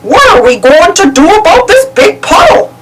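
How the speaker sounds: Goofy's goofy voice; Goofy asking about the puddle. A narrator's reading. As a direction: wonder aloud as Goofy. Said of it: "Goofy asked".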